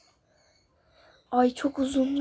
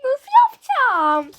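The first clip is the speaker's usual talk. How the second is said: high wailing laugh into a cry; panicked wail; 9 semitones higher, 5 dB louder, slower than usual. Real, not acted. High panicked whine. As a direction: gamer wailing at the obstacles ahead, panicked.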